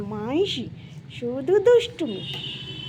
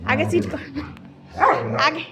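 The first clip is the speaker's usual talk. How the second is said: ordinary delivery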